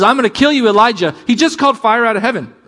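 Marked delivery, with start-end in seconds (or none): 0.0-1.1 high, loud mock threat; little devil's threat. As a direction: throw out the threat in raised, heated mockery. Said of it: "this little comment from a little devil says".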